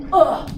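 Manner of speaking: loud hammed-up groan of effort; mock groan. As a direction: groan as if flattened to the floor. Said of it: "just be like".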